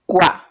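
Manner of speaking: "Cua" said alone with firm force; stressed syllable. Shown as a demonstration. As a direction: put the weight of the voice on "Cua". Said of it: "la sílaba que tiene la mayor fuerza de voz". ("Cua" sola, con fuerza de voz)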